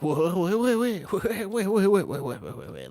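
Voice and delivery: high, loud rapid string of wait; mock excited French person. Streamer pretends to be French, yelling wait. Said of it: "I could be like".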